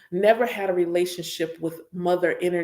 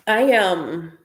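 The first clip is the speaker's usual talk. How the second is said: ordinary talk, nothing marked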